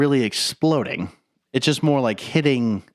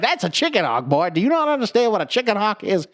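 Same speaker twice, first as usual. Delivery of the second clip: higher exasperated southern rooster; lightly higher southern rooster voice; Foghorn Leghorn impression; 8 semitones higher than usual. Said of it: "He's like".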